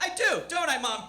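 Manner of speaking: put-on high, loud, hopeful child; eager child. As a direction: lap up the compliment like a kid. Child eagerly believing mother's flattery.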